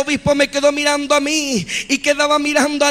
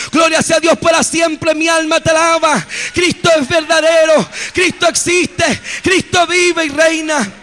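preaching at full force; 2 semitones higher than usual. Acted. Preacher shouting praise to his congregation, fervent. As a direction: lift it up, proclaim God's glory.

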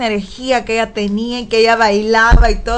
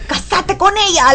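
mock pushy aunt; 6 semitones higher than usual. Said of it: "le decía".

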